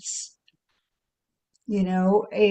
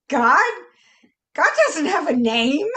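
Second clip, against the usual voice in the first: higher, louder mock Brooklyn accent, a teacher's pronouncement; Ken Wapnick voice; 7 semitones higher, 4 dB louder than usual. Her inner voice sounding like Ken Wapnick, in a mock Brooklyn accent. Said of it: "has taken on the voice of Ken Wapnick".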